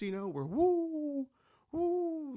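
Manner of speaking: high, slow mock owl woo; owl hoots. Comic, hooting like a flying owl. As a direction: hoot like an owl in costume. Mimicking owls flying around.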